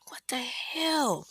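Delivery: slow, high, airy mock bewilderment; startled awake. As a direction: act out being jolted from deep sleep. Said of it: "coming out of a deep sleep like".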